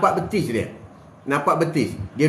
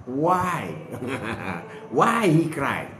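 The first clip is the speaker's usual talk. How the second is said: mock English; 4 semitones lower, 3 dB quieter, much slower than usual. Mock English question, joking.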